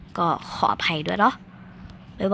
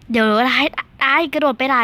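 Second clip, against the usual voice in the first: louder, higher cry of "ไอ้กระโดดไปไหน"; startled outburst; 5 semitones higher, 5 dB louder than usual. Real, not acted. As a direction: yelp "ไอ้กระโดดไปไหน" in alarm at the screen. Game character jumps wrong way.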